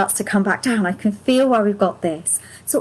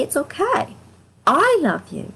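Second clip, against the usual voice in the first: tender self-talk; 6 semitones higher than usual. Shown as a demonstration. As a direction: tell yourself gently it's okay.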